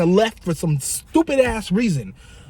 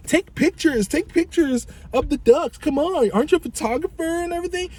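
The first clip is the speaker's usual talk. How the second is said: high, needling mock voice of the friend; teasing friend; 12 semitones higher than usual. Friend teasing the photographer to shoot ducks, high mocking voice. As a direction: rib him in a high teasing voice.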